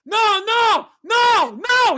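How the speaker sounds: high, loud imitated cab driver's yell; frantic high-pitched mock yelling. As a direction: shout it in alarm as the cabbie. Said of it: "He's yelling".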